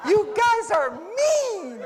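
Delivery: laughing. Real, not acted. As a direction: tease them while laughing.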